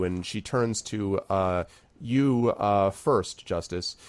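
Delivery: usual speaking voice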